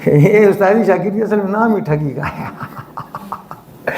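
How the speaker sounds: slowly said, breaking into laughter; laughing. Real, not acted. Teacher joking with students about teaching being trickery, laughing. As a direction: joke that dissolves into a real laugh.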